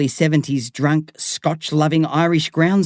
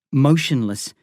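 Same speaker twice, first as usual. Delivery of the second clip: plain reading voice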